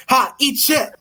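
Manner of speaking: high-pitched, excited taunt; excited gloating. Acted. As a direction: crow over a win. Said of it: "because he's so excited".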